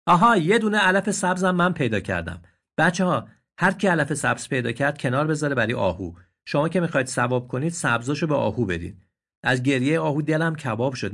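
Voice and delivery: calling out. Acted. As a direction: shout to the others. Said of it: "فریاد زد". (فریاد از گوشهٔ طویله)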